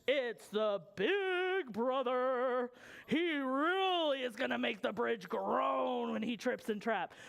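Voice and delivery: drawn-out, ominous announcement; dramatic storytelling. A narrator's reading. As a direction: stretch it out for the big entrance. Dramatic entrance of big brother.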